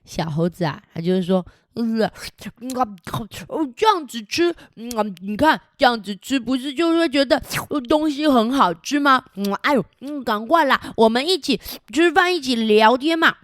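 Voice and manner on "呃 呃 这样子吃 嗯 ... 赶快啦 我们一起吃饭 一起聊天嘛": high, eager little monkey; cheeky monkey voice. Acted. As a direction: chatter like a playful monkey. (调皮小猴子的声音)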